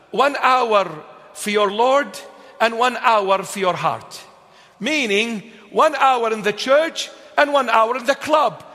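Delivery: thundering sermon cadence; fiery preaching. Acted. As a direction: drive each "one hour" home to the church. Preaching on one hour for the Lord.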